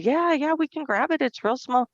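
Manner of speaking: high, eager "yeah, yeah"; chirpy young clerk voice, pitched high; eager carryout kid. Retold or imitated. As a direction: play the keen young store clerk. Carryout staff answering the manager.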